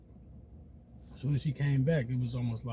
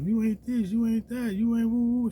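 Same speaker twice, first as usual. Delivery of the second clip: mocking girlfriend; 7 semitones higher than usual. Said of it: "she's just going off".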